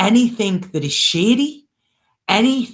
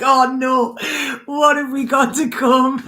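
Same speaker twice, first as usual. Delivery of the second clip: high, laughing mock worry; quoting her own laughing alarm; laughing mock dread; 8 semitones higher than usual. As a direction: fake dread through a laugh. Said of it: "I'm like".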